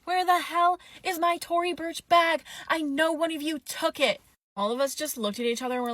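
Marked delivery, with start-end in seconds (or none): 0.0-4.2 high-pitched mock yell at the staff; imitated yelling. Drunk guest accusing restaurant staff over her bag, mock yelling. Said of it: "Like, yelling at us".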